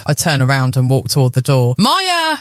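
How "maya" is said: high desperate yell; desperate shout. A narrator's reading. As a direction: call her name in despair. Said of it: "he shouts".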